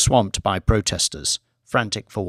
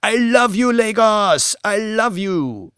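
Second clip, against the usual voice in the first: screamed out; 10 semitones higher than usual. A narrator's reading. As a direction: fling the love out to the whole city. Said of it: "she screamed out".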